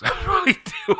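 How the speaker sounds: high laugh breaking through the words; words swept up in a high giggle. Real, not acted. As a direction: laugh as you confess it. Laughs at her own claim.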